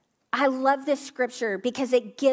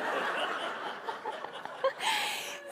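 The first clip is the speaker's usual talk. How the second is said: high breathless laugh; 9 dB quieter than usual. Real, not acted. Laughing after retold whisper.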